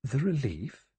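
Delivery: puzzled, rising question; wondering. A narrator's reading. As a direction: echo the word in wonder. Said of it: "Vera said wonderingly".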